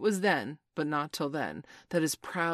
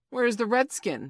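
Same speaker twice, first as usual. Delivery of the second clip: louder, higher, demanding question; abrupt demand; 7 semitones higher, 9 dB louder than usual. A narrator's reading. Hook suddenly remembering Tiger Lily, demanding abruptly. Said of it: "he demanded abruptly".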